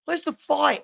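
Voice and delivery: normal talking voice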